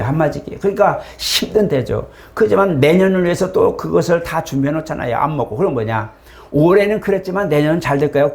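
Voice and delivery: usual speaking voice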